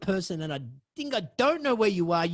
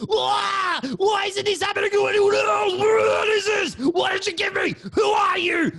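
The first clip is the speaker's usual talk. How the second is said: high, loud screaming in panic; terrified screaming; 6 dB louder than usual. Retold or imitated. The tripping girl shrieks at everyone, high and terrified. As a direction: scream it like she's losing her mind.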